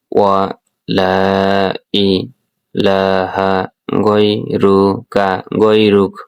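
ওয়া লা ইলাহা গৈরুক drawn out very slowly; slow recitation. Shown as a demonstration. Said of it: "গৈরুক বলবো". (ধীরে টেনে তিলাওয়াত)

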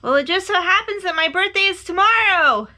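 high, loud excited announcement; eager shouting bear; calling out. A narrator's reading. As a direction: call it far across the mountains. Bear calls across to the moon.

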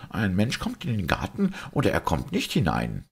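high-pitched, impatient doctor voice; exasperated doctor. Acted. Doctor insisting one either enters or not, exasperated.

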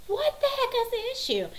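very high-pitched mock exasperation; exasperated demand. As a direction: squeal the question in disbelief. Mom exasperated at squabbling kids.

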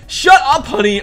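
high, loud playful snap; loud teasing shut up; mock snap. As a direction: tell Honey off, half joking. Snapping at a player.

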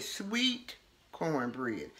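dreamy craving, slow and hushed; savoring. Real, not acted. Savoring favorite food.

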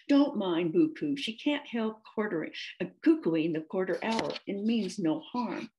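usual speaking voice

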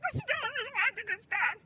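put-on fast, high voice-changed old woman; old lady voice changer. Shown as a demonstration. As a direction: read it through a cheap old lady voice filter. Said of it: "the old lady voice is so, like, it's so bad".

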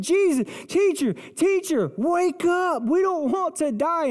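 high, urgent cry for help; panicked pleading. Acted. Frightened disciples to sleeping Jesus in the storm, panicked pleading. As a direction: plead as if shaking someone awake.

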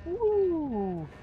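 high gleeful woo; excited whoop. Real, not acted. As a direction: whoop with delight. Bouncing on landing.

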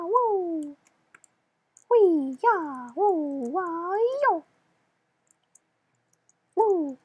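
high, bright-eyed cheering whoops; high inner child voice. Acted. Player whooping through the level as his inner child, in a high voice. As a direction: whoop like an overexcited little kid. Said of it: "Wrong voice".

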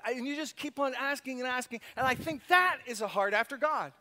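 ordinary talk, nothing marked

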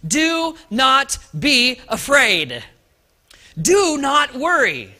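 slow, loud proclamation; booming heavenly reassurance; proclaiming angel. Acted. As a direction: announce it like an angel. Angel's message to the Marys.

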